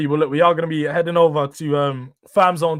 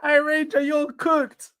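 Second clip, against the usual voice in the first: much higher, put-on teasing voice; mock teasing voice; slower than usual. Streamer taunting chatter Ranger in a silly high voice.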